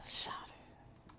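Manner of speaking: soft whispered aside. Real, not acted. Hushing someone, whispered aside. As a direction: breathe it out under your breath.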